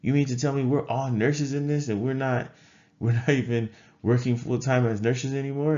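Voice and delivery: amazed that everyone in the room was a nurse; incredulous surprise. Retold or imitated. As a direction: retell the moment you couldn't believe it. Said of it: "'cause I was like".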